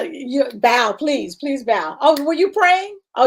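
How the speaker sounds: normal talking voice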